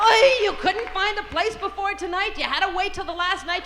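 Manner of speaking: exasperated Yiddish mama nagging. Acted. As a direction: scold your husband with rising questions.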